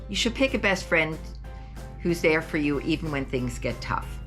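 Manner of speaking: ordinary delivery, nothing marked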